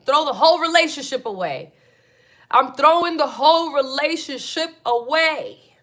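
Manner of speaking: loud, high outburst; fed-up loud declaration; loud rant. Real, not acted. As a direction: throw it away with a yell. Ranting about betrayal, loud.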